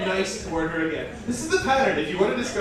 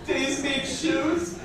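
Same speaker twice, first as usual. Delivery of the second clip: slow, drawn-out mock dialect verse with laughs breaking through; slow goofy verse, laughing through it; comic poem reading; 9 semitones higher, much slower than usual. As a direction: read the silly cat poem slowly, fighting the giggles.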